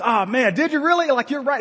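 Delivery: high, laughing amazement; excited disbelief. Retold or imitated. Father hearing his son shook Obama's hand, high laughing delight. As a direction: squeal it like you can't believe it. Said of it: "I says".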